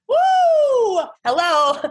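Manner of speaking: jolted by his outburst; shocked exclamation. Retold or imitated. Woman recalls her reaction to the date's outburst, shocked whoop. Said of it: "And I was just like".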